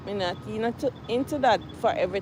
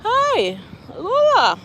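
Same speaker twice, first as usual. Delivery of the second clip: calling out; 11 semitones higher, 8 dB louder, much slower than usual. Real, not acted. Greeting a friend met on walk.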